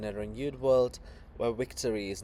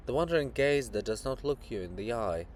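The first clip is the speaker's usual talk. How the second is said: normal talking voice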